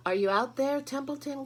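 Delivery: high, loud call across the barn. A narrator's reading. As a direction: call out for Templeton. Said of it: "called Wilbur".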